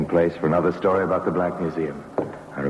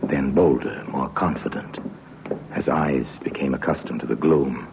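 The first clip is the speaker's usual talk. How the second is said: hushed, tense storyteller whisper; breathy narrator's whisper building suspense; whispered suspense narration; 3 semitones lower than usual. A narrator's reading. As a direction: draw the listener into the dark room.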